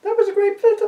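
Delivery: female voice. Acted. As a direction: pitch it up into a woman's voice. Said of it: "pierced by a female voice".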